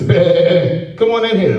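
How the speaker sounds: a lightly bleated bah, then welcoming; sheep bleat. Retold or imitated. Preacher mocking his people as sheep, light bleat. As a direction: bleat like a naive sheep waving them in. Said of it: "We sheep".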